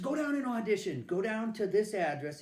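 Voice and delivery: imitating a loud, high, pushy director; urging director. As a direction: push him to go audition, loudly. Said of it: "He says".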